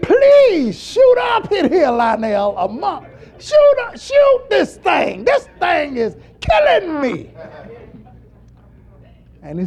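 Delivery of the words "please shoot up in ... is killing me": high, drawn-out shouted plea; desperate hollering. Retold or imitated. Man fighting a wildcat begs his friend, hollering desperately. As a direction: holler for help, slow and loud. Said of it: "we can holler out like that".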